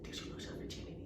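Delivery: unvoiced hushed whisper of a nosy cousin; whispered question. Cousin whispering a nosy question at the store, hushed. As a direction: lean in and whisper it secretly. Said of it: "my cousins was like".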